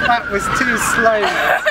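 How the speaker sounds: slow words through laughter; laughing. Real, not acted. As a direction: laugh while complaining, drawn out. Laughing after the soaking.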